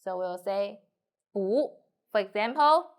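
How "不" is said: 不 rising in second tone. Shown as a demonstration. Teacher shows the changed 不, in rising second tone. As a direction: lift the pitch through 不. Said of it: "it will change to second tone".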